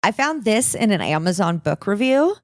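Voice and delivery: ordinary delivery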